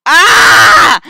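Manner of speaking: loud, high scream; sudden full-voiced shriek of alarm; startled scream. Giant spider drops from the trees, loud scream.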